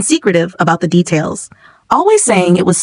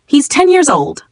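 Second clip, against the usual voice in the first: higher-pitched protective exclamation, lightly voiced; exclaiming; 7 semitones higher, slower than usual. A narrator's reading. Mother objecting on a child's behalf, exclaiming. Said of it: "Missus Chen exclaimed".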